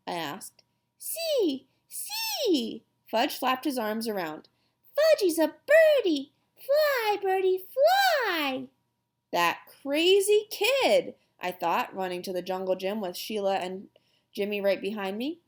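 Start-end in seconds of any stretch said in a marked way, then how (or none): 1.0-2.8 put-on very high little-boy squeal; toddler showing off. A narrator's reading. Toddler calling from jungle gym. 4.9-8.7 put-on high, sing-out little-boy voice; excited toddler. A narrator's reading. Toddler plays bird. 9.3-11.1 higher, louder, drawn-out exclamation; exasperated brother. A narrator's reading.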